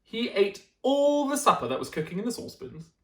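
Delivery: plain reading voice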